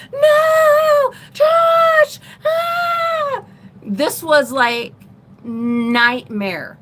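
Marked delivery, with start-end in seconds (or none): 0.1-3.4 high, loud anguished cry; desperate crying shout. Retold or imitated. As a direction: wail his name as he's chased off. Pleading as mom chases boyfriend.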